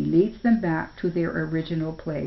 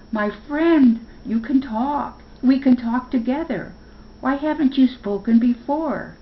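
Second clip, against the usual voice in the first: excited; 7 semitones higher, 5 dB louder than usual. Acted. Spark thrilled Chipper talks.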